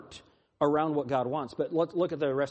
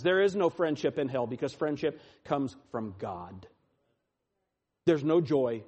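normal talking voice

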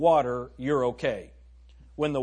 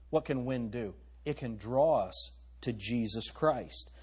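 normal talking voice

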